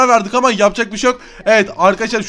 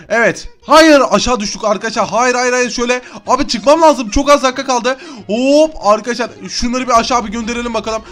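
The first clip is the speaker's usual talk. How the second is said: ordinary talk, nothing marked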